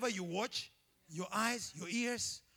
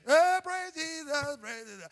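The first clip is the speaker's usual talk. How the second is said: slow drawn-out praise Jesus, higher; lightly hammed-up televangelist; mock TV preacher; 4 semitones higher, 8 dB louder, slower than usual. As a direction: imitate a TV preacher's slow cry. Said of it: "T B N".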